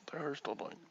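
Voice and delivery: a soft whisper of the line. Shown as a demonstration. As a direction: breathe it out without voice.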